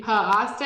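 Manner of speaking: "puh asta" for pasta, split after puh; "puh asta", puh as its own beat; added schwa sound. A narrator's reading. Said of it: "He added his extra uh sound".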